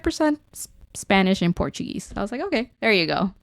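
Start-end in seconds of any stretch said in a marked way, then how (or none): none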